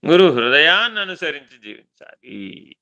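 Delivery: ordinary talk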